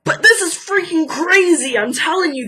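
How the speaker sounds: loud, high and worked up; excited outburst. Real, not acted. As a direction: blurt it out, all fired up. Worked up about robot citizenship.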